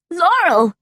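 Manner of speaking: high calling scream of her name; a read-aloud, lightly voiced scream. A narrator's reading. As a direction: call out as she falls. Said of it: "Catwoman screamed".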